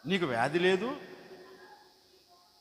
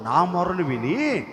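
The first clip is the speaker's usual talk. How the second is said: slow, solemn testimony; 4 semitones lower, 8 dB louder, much slower than usual. Acted. Testimony slows over music.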